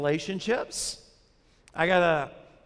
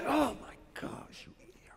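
mock outraged whisper; 7 semitones higher, 8 dB quieter than usual. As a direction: imitate an angry driver almost silently. Mimes angry driver glaring over.

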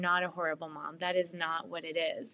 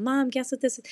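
higher, louder imitation of an excited kid; attention-seeking child; 7 semitones higher, 5 dB louder than usual. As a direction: pester Mom with a child's question.

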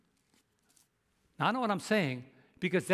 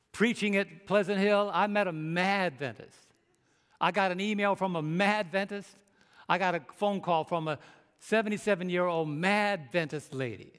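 ordinary talk, nothing marked

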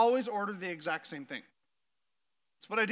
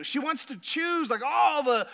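normal talking voice

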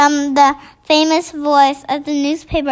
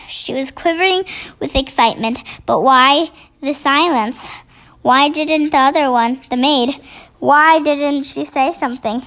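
ordinary delivery, nothing marked

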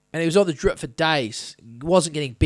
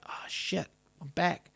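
whispered; 3 semitones lower, 15 dB quieter than usual. Retold or imitated. Waking in ICU, to nurse.

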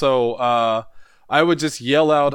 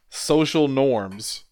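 slow punchline; much slower than usual. Acted. Punchline delivered slowly.

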